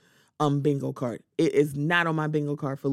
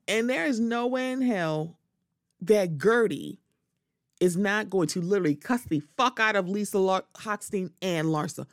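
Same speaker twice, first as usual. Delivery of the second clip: normal talking voice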